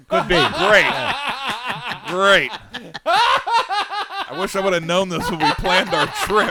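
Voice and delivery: high, loud laughing "great"; laughing sarcasm. Real, not acted. Reacting to the cocaine joke, laughing sarcasm. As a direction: laugh it out, mock dismay.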